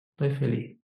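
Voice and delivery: a joyless "Estoy feliz" from a glum face; unconvincing happy. Shown as a demonstration. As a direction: say you're happy without meaning it. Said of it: "Con esa cara no le van a creer".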